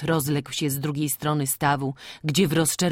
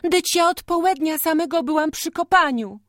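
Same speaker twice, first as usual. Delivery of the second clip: Hanka explaining; 13 semitones higher, 5 dB louder than usual. A narrator's reading. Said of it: "tłumaczyła się cicho Hanka".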